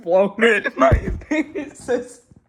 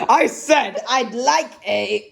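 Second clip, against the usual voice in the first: slow, higher mock bellow; shouting at top of voice; 5 semitones higher, slower than usual. A narrator's reading. Mister Noisy yelling at the butcher, hammed up. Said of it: "shouted Mister Noisy at the very top of his voice".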